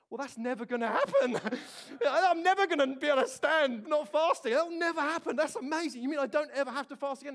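loud and high, excited; retold delight. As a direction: burst out in disbelieving joy. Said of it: "fast until you can't stand".

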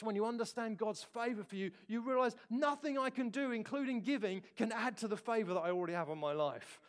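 usual speaking voice

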